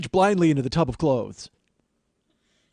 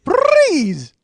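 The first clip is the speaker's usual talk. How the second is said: very high, loud rolled splutter; drowning cartoon rabbit with Australian accent; Roger Rabbit; 11 dB louder than usual. Comedian demonstrating a drowning Australian cartoon, high spluttering yell. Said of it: "Roger Rabbit drowning in Australian".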